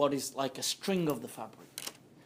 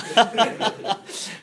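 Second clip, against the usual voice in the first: laugh; 7 semitones higher, 6 dB louder than usual. Real, not acted. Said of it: "I've got some women here".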